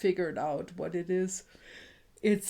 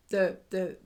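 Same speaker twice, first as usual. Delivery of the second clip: ordinary talk, nothing marked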